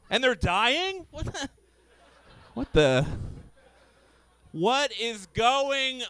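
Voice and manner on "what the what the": ordinary delivery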